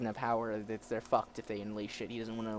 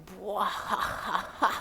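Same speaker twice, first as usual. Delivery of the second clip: slow, high mock evil laugh; villainous laugh; 8 semitones higher, 4 dB louder, much slower than usual. Reader voicing a villain's laugh, theatrical cackle. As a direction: draw out a hammed-up cackle.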